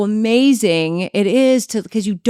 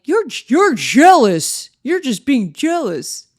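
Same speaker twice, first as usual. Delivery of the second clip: slow, drawn-out accusation, higher than usual; mock gaslighter; 6 semitones higher, 5 dB louder, slower than usual. Mimicking a man gaslighting his girlfriend, slow mock accusation. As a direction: stretch the words to pin the blame on her. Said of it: "That's called gaslighting now".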